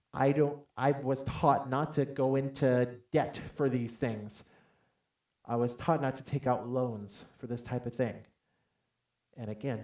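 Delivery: ordinary delivery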